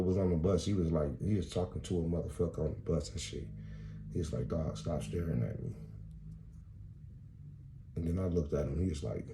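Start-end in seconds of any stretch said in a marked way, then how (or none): 4.5-5.7 a hint of a tough guy, low and soft; low menacing voice. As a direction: growl it quietly at him.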